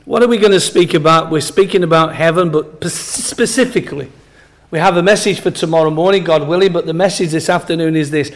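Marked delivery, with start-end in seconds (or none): none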